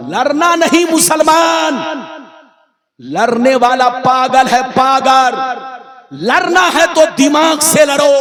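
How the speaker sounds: fiery sermon warning; forceful exhortation. Real, not acted. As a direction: urge the crowd in high, ringing bursts. Preacher exhorts the crowd.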